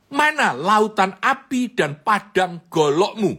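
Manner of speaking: loud, high, slow taunt; mocking shouted challenge. Trainer bangs the desk and taunts the young man loudly. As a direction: throw his own words back at him.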